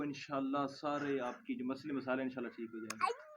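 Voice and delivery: soft soothing comfort. Real, not acted. Host to the crying girl, soft and soothing.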